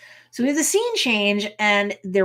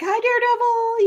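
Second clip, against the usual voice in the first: flirty high greeting; 14 semitones higher, 4 dB louder than usual. Retold or imitated. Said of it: "Titania, of course, is like".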